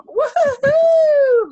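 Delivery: long, high, loud woo-hoo; excited whoop. Real, not acted. Host celebrating the [name] team, slow high woo-hoo. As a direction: stretch the whoop out slowly in celebration.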